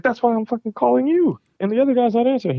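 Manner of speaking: high-pitched exasperated retort; retold exasperation. Developer on the phone to his partner about changed numbers, exasperated.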